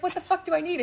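lightly voiced exasperation; mock exasperated Aang. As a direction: throw up your hands in disbelief. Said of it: "And he's like".

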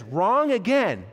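loud, slow, high-pitched verdict; emphatic rejection. Retold or imitated. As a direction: draw out the rejection forcefully. Ethan rejects second answer.

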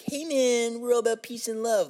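high-pitched mellow hippie. Acted. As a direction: put on a peace-and-love hippie. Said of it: "stereotypical hippie voice".

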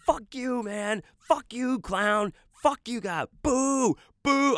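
high-pitched heckling shout, retold; yelling. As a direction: jeer from the crowd in a high yell. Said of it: "I was, like, yelling".

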